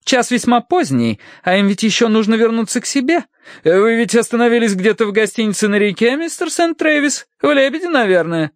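high, haughty, frosty matron; coldly hint that the guests should leave. A narrator's reading. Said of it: "снова напустила холода". (холодно, высоким светским голосом)

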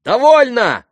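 high, loud ringing cry; ringing shout. A narrator's reading. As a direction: cut them off with a ringing voice. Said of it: "крикнул он своим звонким голосом". (звонкий крик)

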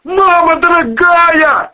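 high, drawn-out, very loud wail; terrified yell. A narrator's reading. Man tumbling back inside from the monster, yelling. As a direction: yell it out in alarm. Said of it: "завопил Волына".

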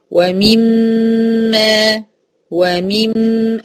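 "ومم" with clear "ee" and long held hum; full kasra. Shown as a demonstration. Teacher models slowly, "ومم" with full kasra and hum. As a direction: complete the "mi" in "ومم" and hold the meem. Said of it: "أتم كسر الميم".

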